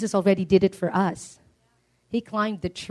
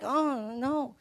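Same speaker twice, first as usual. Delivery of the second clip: dismayed; 10 dB quieter than usual. Retold or imitated. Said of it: "So they were like".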